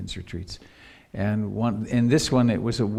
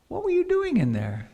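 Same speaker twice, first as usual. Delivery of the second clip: lightly voiced questioner, pitched much higher; Ruth Dennison. Teacher asking her student about the retreat, lightly imitated in high pitch. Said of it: "she came to me and said".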